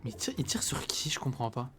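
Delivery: unvoiced puzzled whisper. Real, not acted. Player wonders aloud who is shooting, whispered. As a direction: breathe the question.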